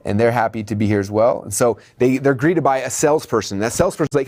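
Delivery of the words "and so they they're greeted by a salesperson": normal talking voice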